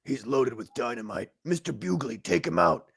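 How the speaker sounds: low commanding captain. Acted. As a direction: bark the order like a captain. Captain giving orders in cartoon.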